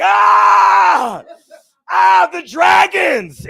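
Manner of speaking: wild high-pitched mock scream; crackhead screaming. Crackhead walking around seeing dragons, hammed-up screaming. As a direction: scream long and loud, as if seeing monsters. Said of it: "they'll be screaming".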